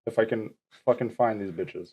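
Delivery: usual speaking voice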